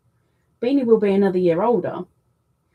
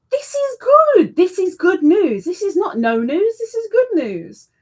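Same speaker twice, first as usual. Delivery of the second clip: much higher, louder cheering; delighted; 10 semitones higher, 5 dB louder than usual. Real, not acted. Host cheering a viewer's weight loss with delight. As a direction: celebrate the good news.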